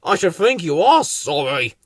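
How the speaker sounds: mildly huffy character voice; offended old grump; grumbling. A narrator's reading. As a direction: grumble it, put out. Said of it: "grumbled Slow Coach".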